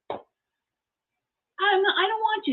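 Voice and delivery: high, whimpering mock protest; retold tearful reluctance. Wife refusing to go out in pajamas, mock whimper. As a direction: balk in a pretend weepy voice. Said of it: "here I am crying like an idiot".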